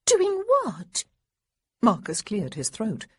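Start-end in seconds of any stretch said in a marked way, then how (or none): 0.1-1.0 put-on high, loud girlish challenge; pressing little girl. A narrator's reading. Little girl pressing Marcus, high and shrill-edged. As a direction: push him for an answer.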